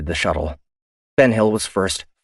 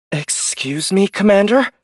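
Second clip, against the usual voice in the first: much higher and louder protest; indignant officer; 14 semitones higher, 4 dB louder than usual. Acted. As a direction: push back in disbelief. Ian to Otero.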